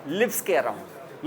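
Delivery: normal talking voice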